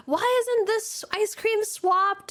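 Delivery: high, drawn-out scolding; mock angry boss. Boss scolding parlor staff, mock angry. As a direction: imitate a boss yelling over a petty fault. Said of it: "He would, like, get angry and yell at us".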